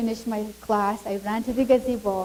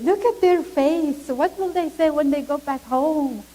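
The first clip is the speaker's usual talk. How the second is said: begging; 6 semitones higher, 3 dB louder than usual. Retold or imitated. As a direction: plead on their behalf, voice lifted. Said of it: "just begging them".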